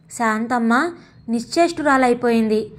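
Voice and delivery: plain reading voice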